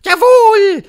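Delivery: very loud, high yell; thundering Grand High Witch. A narrator's reading. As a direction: blast it out shrilly. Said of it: "donnerte die Hoch". (donnernde Hexe, schrill und sehr laut)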